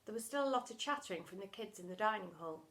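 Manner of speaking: plain reading voice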